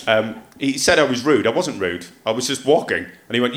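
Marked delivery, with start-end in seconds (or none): none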